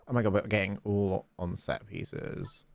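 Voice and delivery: normal talking voice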